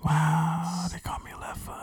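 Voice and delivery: very soft, awed whisper; mock mystical whisper. Joking about a spirit name, hushed whisper. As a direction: breathe it out in fake spiritual wonder.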